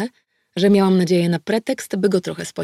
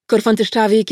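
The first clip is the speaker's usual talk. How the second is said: name called out louder, firm; formal arrest call; 4 semitones higher, 7 dB louder than usual. Acted. Detective arrests the suspect by name, loud and firm. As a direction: announce his full name to arrest him.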